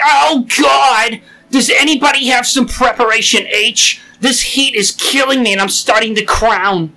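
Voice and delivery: mock distress call. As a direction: overact agony over the radio. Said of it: "get on the walkie-talkie and be like".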